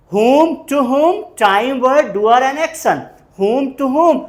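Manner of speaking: WHOM and TO WHOM drawn out slowly, high and loud; emphatic formula drill. Shown as a demonstration. Teacher drilling a question formula for the students, emphatic. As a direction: punch out "whom", "to whom" loudly, word by word.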